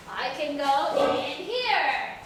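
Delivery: normal talking voice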